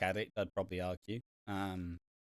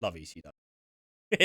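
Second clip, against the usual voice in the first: deadpan murmur; 8 semitones lower, 9 dB quieter, faster than usual. Retold or imitated. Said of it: "I'm like".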